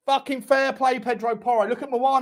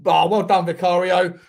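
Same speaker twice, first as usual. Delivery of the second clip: ordinary delivery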